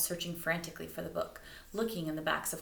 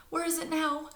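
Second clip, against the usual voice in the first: high, louder anxious question; anxious ask; 11 semitones higher, 5 dB louder than usual. Acted. Stella asking the neighbour anxiously. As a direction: plead for where it went. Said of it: "Stella asked anxiously".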